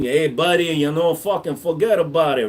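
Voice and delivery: hammed-up New York wiseguy talk; New York accent. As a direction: do a mock New Yorker, casual and chummy. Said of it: "I sounded like I was from New York".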